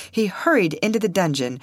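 ordinary delivery, nothing marked

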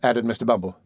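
plain reading voice

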